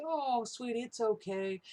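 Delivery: high, soothing mock comfort; lightly voiced parent soothing a girl. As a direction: coo it like calming a hurt child. Comforting a crying little girl.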